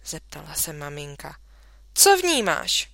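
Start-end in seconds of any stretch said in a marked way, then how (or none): 1.9-2.9 sharp demand. A narrator's reading. Mother demands what's inside.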